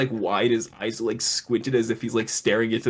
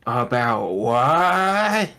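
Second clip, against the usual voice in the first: slow, puzzled and mocking; a dragged-out mock confusion; slow drawn-out question; much slower than usual. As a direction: stretch "About what?" out very slowly. Betty drawn out in retold scene.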